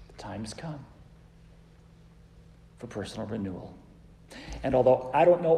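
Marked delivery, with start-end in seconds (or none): 0.1-3.7 much softer and lower; hushed conclusion. Real, not acted. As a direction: let it fall to a hush. Sermon climax softened.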